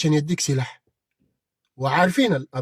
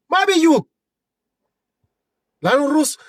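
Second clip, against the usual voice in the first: emphatic shout; 7 semitones higher, 4 dB louder than usual. Real, not acted. Angry rant at rival.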